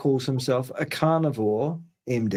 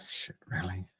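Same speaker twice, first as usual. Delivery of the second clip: quiet exasperated sigh; 6 semitones lower, 11 dB quieter than usual. Real, not acted. Sighs at screen share.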